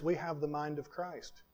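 quiet, falling close on "we have the mind of Christ"; soft statement. Real, not acted. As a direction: drop the voice, let it settle. Repeats the key phrase softly.

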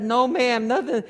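high, loud mock tearful reply; imitating a crying man; imitated crying. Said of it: "He starts crying".

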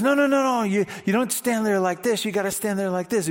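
put-on high, insistent coach correcting a kid; lightly acted coach jumping in to correct. Coach correcting kid's batting stance.

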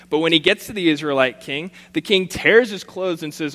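formal public reading of scripture. Acted. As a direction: proclaim the passage to the congregation. Reads scripture passage aloud.

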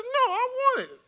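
high, mock-tearful child; Kylie voice. Four-year-old refusing dad's advice to share, imitated crying whine. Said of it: "that's my Kylie voice".

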